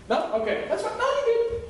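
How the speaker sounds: sarcastic God. Retold or imitated. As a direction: brush it off with playful sarcasm.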